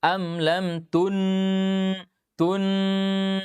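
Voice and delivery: "tun" held very slowly with a plainly sounded nun; clear nun demo. Shown as a demonstration. Teacher showing students a clear nun, very slow. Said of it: "itu jelas nunnya".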